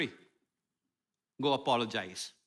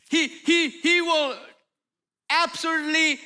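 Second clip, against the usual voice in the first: rising preaching; 6 semitones higher, slower than usual. Real, not acted. Preaching climax about God.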